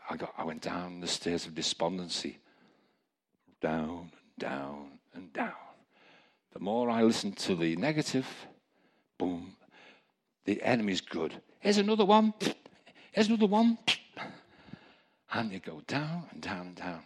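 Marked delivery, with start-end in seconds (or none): 3.6-5.5 slow, heavy step down on each "down"; sinking into despondency. Retold or imitated. Preacher retelling a descent into despair, slow and sinking. 11.6-13.8 high, loud mock tormentor; taunting enemy. Preacher voicing the enemy piling on negatives, high taunting shout.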